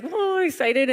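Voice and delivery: high, loud mock excitement; imitating excited bankers; excited whoa. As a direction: play the bankers thrilled. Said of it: "Everyone's like".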